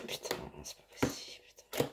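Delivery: under-the-breath whispered swearing; muttered curse. Real, not acted. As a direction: mutter it to yourself in frustration. Struggling to open the box.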